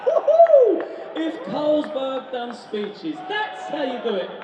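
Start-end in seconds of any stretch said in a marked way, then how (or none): 0.0-0.8 loud, high whoop of delight; excited crowd-pleasing yell; woohoo cheer. Real, not acted. Cheering after a speech.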